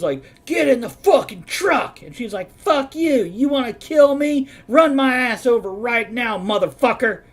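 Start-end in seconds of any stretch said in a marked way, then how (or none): 0.4-2.0 loud, raised-pitch bellowed order; shouted command at full voice. Retold or imitated. Acting out a shouting redneck man. 2.6-7.2 loud, high, furious comeback; full-voiced street-fight yelling. Acting out the woman yelling back.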